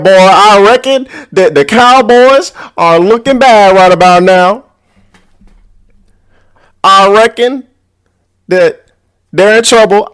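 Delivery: high-pitched, teasing cowboy twang; put-on cowboy accent. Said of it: "why I got this accent".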